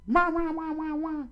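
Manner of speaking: very high falsetto yapping; dog barking. Acted. Dog keeps barking at the lion, high yaps. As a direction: bark shrilly like a small dog. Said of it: "continued on barking".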